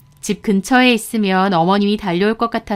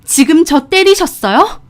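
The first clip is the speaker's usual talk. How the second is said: loud, high outraged question; angry shout; 8 semitones higher, 5 dB louder than usual. A narrator's reading. Daughter-in-law just hit by mother-in-law, angry shout back. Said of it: "저도 고함 질렀어요".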